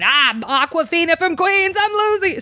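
high-pitched, ranting Queens caricature; hammed-up New York meltdown; Aquafina from Queens. Customer carrying on in the hallway, mock Queens accent. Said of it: "like, carrying on".

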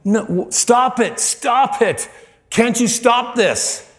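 high, drawn-out desperate cry; screaming plea. Retold or imitated. Man begging the angel to stop it, screaming. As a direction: plead at the top of your range. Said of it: "he starts screaming at the angel".

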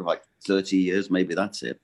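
ordinary talk, nothing marked

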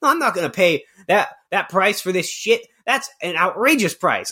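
lightly hammed-up angry caller; mock outraged phone haggle. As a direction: play a man outraged at a price. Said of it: "He's going like".

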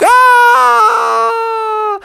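long high loud scream. Acted. Clown dragged away by elephant, screaming. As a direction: wail high while being hauled off.

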